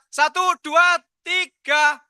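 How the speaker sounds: high-pitched photo countdown; calling out countdown. Real, not acted. Host counting the group in for a photo, high calling-out. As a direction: count it out for everyone to hear.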